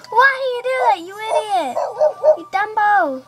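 loud, high-pitched telling-off; mock scolding. Girl character telling off the boy, mock scolding. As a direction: scold like an exasperated game character. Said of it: "she's just like".

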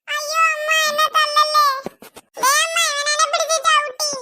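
high frantic begging; frightened pleading. Acted. Child begs mother not to hit him, very high and frantic. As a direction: plead to escape a beating.

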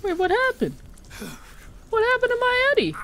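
very high, distressed squeal of disbelief; mock distraught. Player finding Eddie gone, mock distraught squeal. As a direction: squeak it out in playful horror. Discovers Eddie has died in the game.

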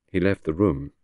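usual speaking voice

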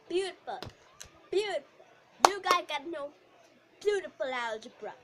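loud, vain diva teacher; popular teacher. Acted. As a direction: show off how beautiful you are. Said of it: "the popular teacher".

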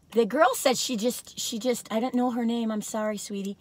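ordinary delivery

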